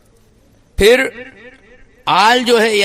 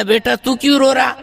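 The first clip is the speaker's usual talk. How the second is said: put-on calling child; 6 semitones higher than usual. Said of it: "بچے کو بلایا".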